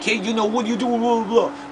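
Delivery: high, loud mocking kid; taunting neighbor. Neighbor boy mocking the narrator, high and loud. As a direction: toss it off like a brash bully. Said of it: "he was like".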